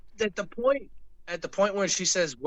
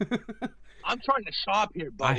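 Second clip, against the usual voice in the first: sarcastic retort; 6 semitones higher, slower than usual. Retold or imitated. Dad's punchline to the stranger.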